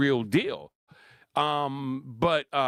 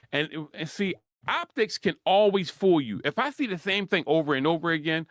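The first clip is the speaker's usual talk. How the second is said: usual speaking voice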